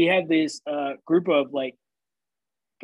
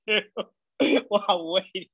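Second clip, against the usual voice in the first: cracking up; 3 semitones higher than usual. Real, not acted. Host cracking up at his own bathroom story, laughing as he speaks. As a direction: struggle to finish, breaking into laughter.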